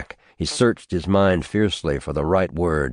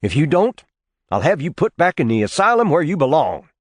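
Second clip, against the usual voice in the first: louder, higher and fast, barely held in; angry threat; 10 semitones higher, 4 dB louder, faster than usual. Acted. Nephew threatening his uncle with the asylum, angry and fast. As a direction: threaten him, temper rising.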